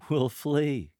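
high, loud, amused answer; lighthearted, laughing. Shown as a demonstration. Lector laughing at sorrow, high and lighthearted. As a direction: toss it out as a joke, pitched high. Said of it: "You're laughing at this. Your voice has a higher pitch".

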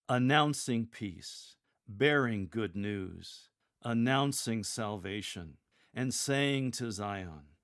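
slow, with "announcing", "bearing" and "saying" punched; a steady parallel cadence, stressing each verb; emphasize the verbs. Shown as a demonstration. Lector modelling the four lines, slow and stressing each verb. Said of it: "Emphasize the verbs in each of those four lines".

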